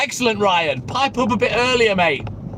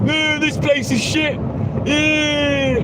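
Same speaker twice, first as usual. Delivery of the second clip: higher, slow, whining mock moan; mock crybaby whine; 5 semitones higher, much slower than usual. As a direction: drag it out like a sulky whinger. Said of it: "You don't hear me crying on a live".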